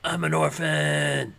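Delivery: mock-tragic superhero, slow; Batman voice. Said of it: "That's basically what the song sounds like".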